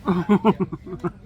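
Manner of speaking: short surprised laugh after a bite; amused laugh. Real, not acted. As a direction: laugh at the dribble.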